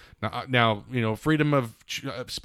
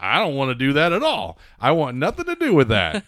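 mock whiny refusal; 4 semitones higher, 5 dB louder than usual. Mocking anti-mask shoppers.

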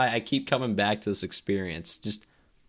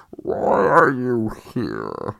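Bane impression; 6 dB louder, much slower than usual. Quoting Bane in the tunnel.

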